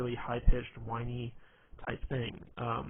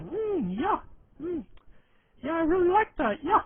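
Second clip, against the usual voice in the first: complete idiot voice, far higher and loud; goofy, high-pitched dimwit yeah-saying; Adam impression; 8 dB louder than usual. Mocking a friend to make girls laugh, high idiot impression. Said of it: "when we do our impression of him, we make him sound like a complete idiot".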